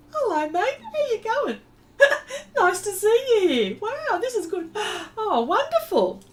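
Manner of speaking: high, loud, laughing greeting; thrilled high laughing welcome to a friend; delighted surprise. Real, not acted. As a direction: squeal with joy at a friend showing up. Surprised a friend joined stream.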